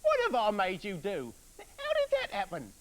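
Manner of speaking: very high, loud astonished wife; amazed wife. Acted. Wife asks her husband in amazement what happened, very high. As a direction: gasp out the question in disbelief. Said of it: "asked his wife in amazement".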